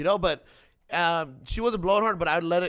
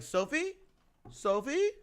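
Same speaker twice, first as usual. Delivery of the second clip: name thing; 6 dB quieter than usual. Retold or imitated. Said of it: "Are you doing that name thing?".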